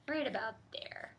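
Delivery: soft unvoiced whisper; whispered aside pointing at the map. Real, not acted. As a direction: whisper it to yourself.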